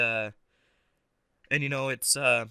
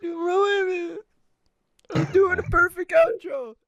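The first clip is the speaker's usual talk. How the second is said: loud, very high wail; high-pitched mock-tantrum cry; mock outrage; 5 dB louder, slower than usual. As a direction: whine loudly at being interrupted. Complaining at interrupted outro.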